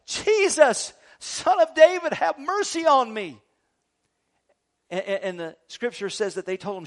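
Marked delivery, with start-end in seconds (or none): 0.1-3.4 high, loud call across a crowd; crying out. Acted. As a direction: blind man calling out for help. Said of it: "and he cries out".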